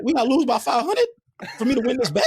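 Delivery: high, baffled protest; incredulous. Retold or imitated. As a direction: question it in comic disbelief, pitch way up. Retelling disbelief at betting line.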